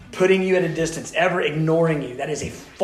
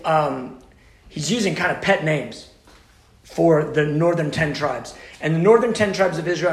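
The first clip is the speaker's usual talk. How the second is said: ordinary delivery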